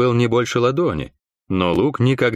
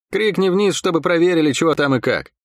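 higher-pitched, mildly urgent order; raised-pitch command without shouting; voiced order; 7 semitones higher than usual. A narrator's reading. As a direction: give a guard's instruction, pitched up. Guard gives an order.

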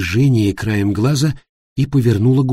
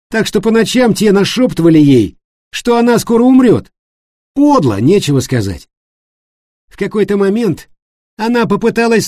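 high, loud, emphatic storytelling in character; animated accuser David; 10 semitones higher, 6 dB louder than usual. Acted. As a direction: pitch it up and lay out the facts forcefully. Voices David recounting the scandal. (высокий, громкий голос увлечённого рассказчика-обвинителя)

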